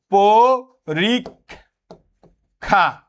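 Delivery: পরীক্ষা drawn out very slowly, each syllable apart. Shown as a demonstration. Teacher sounds out পরীক্ষা syllable by syllable for students. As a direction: split পরীক্ষা into its syllables, very slow. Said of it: "তাহলে আমরা কি বললাম?".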